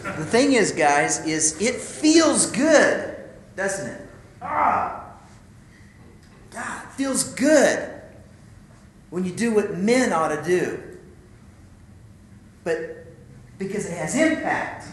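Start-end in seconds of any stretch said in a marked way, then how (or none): 1.3-10.8 slow, higher, savouring delivery; relishing. Real, not acted. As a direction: savour every word of how good it feels. Preaching the joy of impact.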